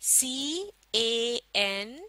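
each letter stretched out very slowly; teacher spelling C-A-N very slowly. Shown as a demonstration. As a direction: sound out C-A-N for the class to repeat. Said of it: "आप भी मेरे साथ पढ़िए".

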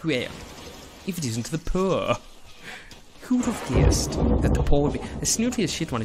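snooty mock-aristocrat English; snooty English accent. Shown as a demonstration. Snob greeting the poor, hammed-up snooty English accent. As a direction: sneer in an exaggerated posh English accent. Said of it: "Is just my Pip Mandarin accent".

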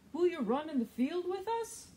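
eager puppy asking to play; excited dog. Acted. Storyteller voices Dog, excited and fast. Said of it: "said Dog".

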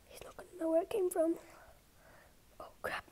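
hushed fast whisper; tense whisper. Real, not acted. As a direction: whisper so he won't hear. Hiding from seeker nearby.

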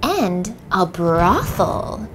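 drawn out, slow; slow dramatic reveal. Acted. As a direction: savour each word slowly.

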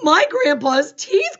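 loud, slow, high wail; big acted cry of alarm; dismayed cry. A narrator's reading. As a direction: shout each word in dismay. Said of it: "cried Manny".

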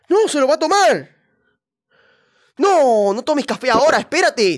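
high, loud panicked no; alarmed shout. Real, not acted. Streamer shouting at the teacher not to drink, high alarmed. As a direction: yell to stop her drinking.

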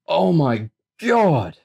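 high, slow, appalled outcry; disgusted exclamation. A narrator's reading. Boy hit by a blast of sewage stench, appalled. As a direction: stretch each word out in revulsion.

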